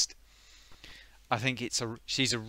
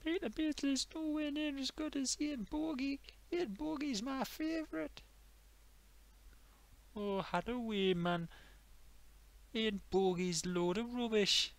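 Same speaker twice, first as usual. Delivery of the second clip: Geordie lads arguing; 12 semitones higher, 4 dB quieter than usual. Acted. Mates argue footballers in skit.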